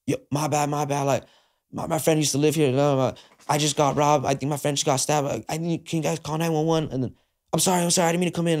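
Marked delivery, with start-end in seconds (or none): 0.1-6.9 higher, frantic pleading voice; retelling his apology to a family after bursting into their home mid-dinner, panicked. Retold or imitated. Barging into stranger's home, panicked. 7.5-8.6 higher, frantic pleading voice; retelling his apology to a family after bursting into their home mid-dinner, panicked. Retold or imitated.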